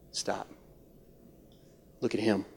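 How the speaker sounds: very soft, low near-whisper; hushed command. Real, not acted. Preacher urging the church off self-focus, hushed. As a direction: drop right down to a hush. Urging congregation to stop looking inward.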